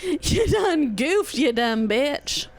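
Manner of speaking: drawn-out, high-starting taunt; playful insult to a dog; mock scolding. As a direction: tease slowly with stretched words.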